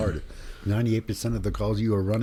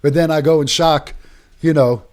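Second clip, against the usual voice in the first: louder and higher, worked up; telling fellow medics about a revived patient, excited and louder; excited storytelling; 6 semitones higher, 9 dB louder than usual. Real, not acted. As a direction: tell it all fired up, voice climbing.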